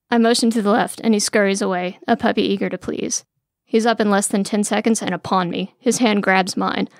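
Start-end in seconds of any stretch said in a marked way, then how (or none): none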